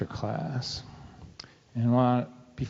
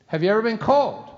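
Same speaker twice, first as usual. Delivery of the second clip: loaded question; 8 semitones higher, 5 dB louder than usual. Retold or imitated.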